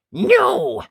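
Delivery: Ned defying his mum for the first time; cross no. Acted. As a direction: fling it out, hot and cross. Ned refusing Dad.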